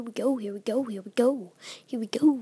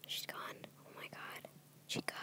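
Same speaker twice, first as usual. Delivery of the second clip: normal talking voice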